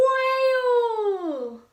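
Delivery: loud high spooky wail; wailed word. A narrator's reading. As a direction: wail it like a ghost. Narrator wails the word.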